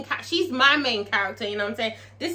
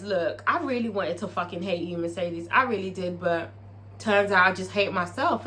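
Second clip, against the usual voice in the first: confessional; 6 semitones lower, 3 dB quieter than usual. Retold or imitated. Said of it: "you know, says".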